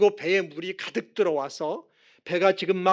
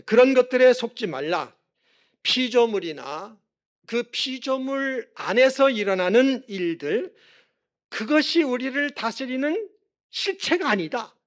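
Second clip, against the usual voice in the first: raised, higher-pitched pulpit voice; emphatic preaching; 6 semitones higher, 4 dB louder than usual. Real, not acted. Preacher warning not to be fooled by creation, emphatic preaching.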